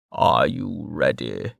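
low and very slow; solemn Westbridge. A narrator's reading. Westbridge announcing the moment, low and slow. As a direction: weigh every word.

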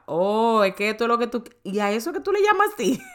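a mother suddenly getting it, rising with delight; retold realization. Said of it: "ahí yo decía". (madre que por fin entiende, cada vez más aguda y emocionada)

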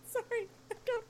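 giggling through the words, high; helpless tickled laughter; laughing. Real, not acted. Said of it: "I'm tickled".

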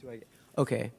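hoarse, worn-out voice. Real, not acted. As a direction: voice half gone. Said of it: "my voice is a bit gone".

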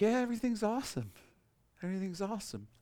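high, breezy pretend-everything's-fine; mock fake cheer. Pastor mocking a churchgoer who hides a wrecked life, fake cheer. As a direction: play the churchgoer faking that all is great. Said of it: "don't be like".

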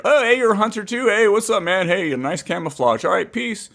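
mock chummy hail to a fellow hunter; friendly hunter greeting. Two hunters meeting out there, friendly mock greeting.

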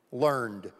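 a low echo of Learned; repeated for emphasis. Shown as a demonstration. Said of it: "I emphasize the word taught".

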